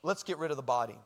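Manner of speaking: low, softened mobster-style line; mafia movie mobster. Retold or imitated. Boy plotting after mafia movies, mock mobster. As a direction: drop it low like a movie gangster. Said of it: "watched one too many mafia movies".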